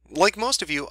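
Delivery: normal talking voice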